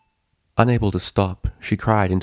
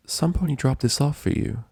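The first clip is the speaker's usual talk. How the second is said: gentle nurse; 7 semitones higher than usual. A narrator's reading. Nurse voiced higher.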